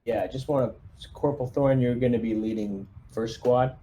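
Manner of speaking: ordinary talk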